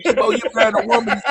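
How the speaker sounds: high, fast, laughing through it; laughing disbelief. Real, not acted. Teasing old friend about his marriage, laughing. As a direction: burst out laughing mid-line.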